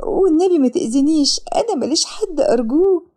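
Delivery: high-pitched begging with mild tears; tearful pleading. A narrator's reading. Woman alone begging her attacker for mercy, tearful pleading. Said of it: "وسارة ببكاء".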